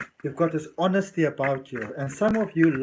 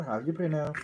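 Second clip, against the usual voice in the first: usual speaking voice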